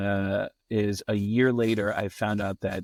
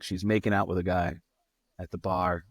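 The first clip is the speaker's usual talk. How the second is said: ordinary talk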